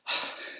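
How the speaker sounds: soft exasperated sigh; quiet, reproachful sigh; pointed sigh. Pretend. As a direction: let out a disapproving sigh. Weary pause at non-mask wearers.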